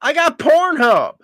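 high, smug brush-off; a husband brushing off his wife's threat; cocky dismissal. Shown as a demonstration. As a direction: toss it off like you don't care. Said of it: "That's when you say".

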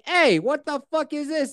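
mock outrage at the intruder; outraged Jen. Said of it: "she's like".

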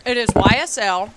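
talking with a mouth full of cookie. Real, not acted. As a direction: speak through a bite of cookie. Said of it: "I'm eating a cookie".

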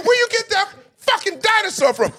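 high, loud disbelief; high mock outrage; incredulous yell. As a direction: yell at the friend over the dog. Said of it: "I said".

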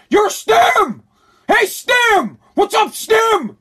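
high shouted taunts out the car window; mock yell. Host yelling at strangers from his car, high mocking shout. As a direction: holler at passersby from the car.

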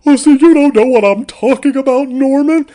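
overplayed stage mother scolding Norman; a shrieking Broadway mother; Linney as Norma Bates. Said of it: "Laura Linney were playing Norman Bates' mother".

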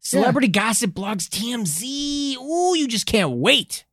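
hammed-up tabloid excitement; mock gossip gushing. Mock-gushing over celebrity gossip.

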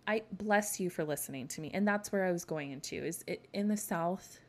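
usual speaking voice

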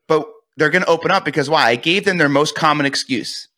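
ordinary talk

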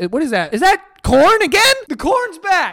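high, loud exasperated question; high exasperated disbelief at the corn. Real, not acted. Corn reappears in video.

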